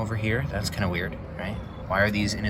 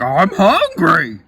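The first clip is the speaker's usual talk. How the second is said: higher, louder, very slow odd drawl; crazy tree voice; 8 semitones higher, 8 dB louder, much slower than usual. Retold or imitated. Confused tree demanding food from the player, slow and loud. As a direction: stretch it out like a dazed hungry tree. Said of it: "he's like".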